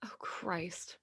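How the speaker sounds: breathy unvoiced dismay under the breath; a muttered whisper of disgust; whispered groan. Real, not acted. Cohost's Dane Cook idea meets a whispered groan.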